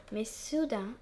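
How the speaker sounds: ordinary delivery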